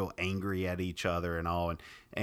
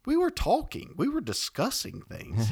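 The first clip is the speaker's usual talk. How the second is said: retold protest; 10 semitones higher, 4 dB louder than usual. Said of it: "I was like".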